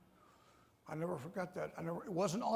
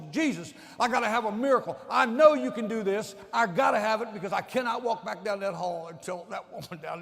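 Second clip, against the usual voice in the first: loud, higher cry for a miracle; desperate plea; 5 semitones higher, 10 dB louder than usual. Retold or imitated. Father begging Jesus for his son, loud. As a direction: beg heaven at full voice. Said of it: "I began to call on Jesus".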